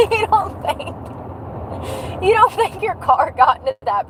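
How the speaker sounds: squeaky disbelieving you don't think; high mocking question; high incredulous. Mocking question to bad drivers.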